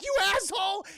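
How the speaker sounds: high, loud cartoon shout; hammed-up yell after the kidnapper; outraged yell. Courage yells at kidnapping fox.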